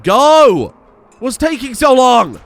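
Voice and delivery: high, loud exasperated yell; frustrated shouts at slow colonists. Real, not acted. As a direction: shout them out the door, fed up. Yelling at colonists in game.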